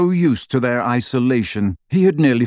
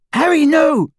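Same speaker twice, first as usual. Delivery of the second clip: high, loud cry of alarm; urgent high-pitched shout of warning; 5 dB louder than usual. Acted. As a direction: cut in loudly to stop them, very high. Panicked warning against saying the name.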